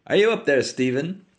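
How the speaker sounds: high, loud call up a stairwell; calling up the stairs. Acted. Haines calls up the staircase for Stephen, loud and high. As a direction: shout up to someone above. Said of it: "voice echoed up the staircase".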